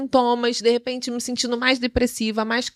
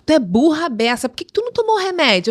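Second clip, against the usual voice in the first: louder, higher mock scolding; scolding friend; 4 semitones higher, 5 dB louder than usual. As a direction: tell her off like a bossy friend.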